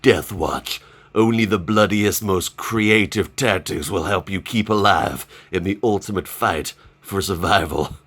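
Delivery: low, ominous trailer narrator; dramatic game-trailer voice. Acted. Vlogger reads out a bloody game's blurb, dramatic trailer voice. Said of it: "You've gotta do a voice like that".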